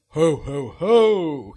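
booming, loud Santa ho-hos. Retold or imitated. Dad in Santa costume greeting his kids, booming Santa. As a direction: bellow it like Father Christmas. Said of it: "threw the Santa costume on quick".

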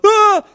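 a sharp, high and loud frightened cry; the lad yelps back in fright; startled shout. Retold or imitated. The stranger outside the window, caught off guard, cries out high and loud. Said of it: "jumped as well".

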